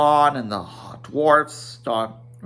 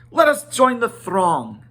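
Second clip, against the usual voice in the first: Kili's eager call; 8 semitones higher, 5 dB louder than usual. A narrator's reading. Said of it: "said Kili".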